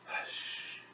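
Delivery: breathy shushing whisper; hushed whisper. Acted. Storyteller whispers hush to listeners. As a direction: hush the room so baby sleeps.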